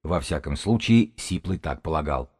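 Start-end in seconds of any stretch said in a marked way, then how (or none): none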